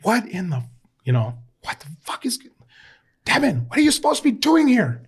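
high, exasperated mock yell at a player; toned-down livid coach. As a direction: imitate a coach blowing up, slightly held back. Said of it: "I'm toning this down".

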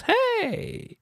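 squeaky high exclamation; startled high yelp; sudden high hey. Real, not acted.

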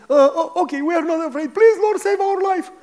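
frightened believers begging to be saved; scared prayer. Retold or imitated. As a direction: beg the Lord like scared congregants. Frightened people praying.

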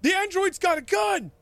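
high, loud panicked cry; high-pitched strained scream; screamed alarm. Acted. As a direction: scream a warning to the whole crowd. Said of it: "he screams".

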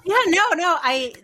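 high, loud laughing agreement; giggling yes. Real, not acted. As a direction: laugh through the words. Laughs along with the story.

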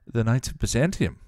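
usual speaking voice

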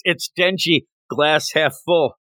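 ordinary talk

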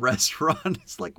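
ordinary delivery

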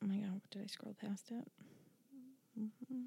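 unvoiced whisper while searching; whispering to self. Real, not acted. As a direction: mutter it under your breath.

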